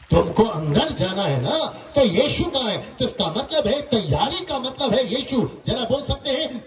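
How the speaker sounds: urgent, ringing sermon parable; emphatic preaching. Real, not acted. Preacher warning the crowd through a ticket parable, preaching. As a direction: hammer out the questions to the congregation.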